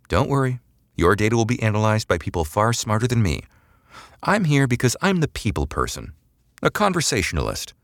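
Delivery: high, breezy, glib taunt. Acted. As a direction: sound flippant and pleased with yourself. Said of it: "dropping the glib affectation".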